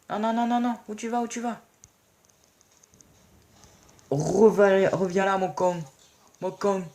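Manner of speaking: high-pitched frantic calling after someone; mock panic. Gamer calling back an escaping enemy, mock panic. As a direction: plead with a runaway in hammed-up alarm.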